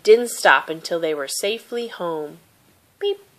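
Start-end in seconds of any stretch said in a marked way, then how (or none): none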